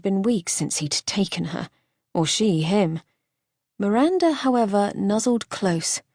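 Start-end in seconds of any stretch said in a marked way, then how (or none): none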